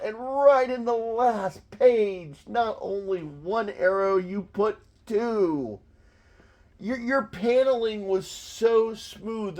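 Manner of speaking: high-pitched, over-the-top mock praise; worked-up, exasperated comic sarcasm; sarcastic rant. Real, not acted. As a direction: gush sarcastically at the incompetent artist. Mocks the artist's arrows and panels.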